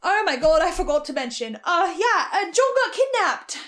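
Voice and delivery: loud, blurted realisation; alarmed, rising outburst; sudden remembering. Acted. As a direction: burst out as the forgotten news hits you. Suddenly remembers forgotten major news.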